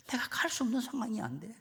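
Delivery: high-pitched, hammed-up helpless plea; mock lament. Missionary asks the elephant what to do, comic high lament. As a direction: beg for an answer in a high wail.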